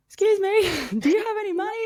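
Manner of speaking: high, loud pleading voice; mock asking a stranger for money; imitating a beggar.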